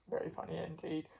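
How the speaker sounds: low, soft, quick penguin voice; penguin character. Pretend. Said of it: "Indy the penguin".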